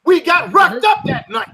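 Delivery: furious at being laughed at, shouting him down; real upset shout; real angry shouting. Real, not acted. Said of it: "you're so upset".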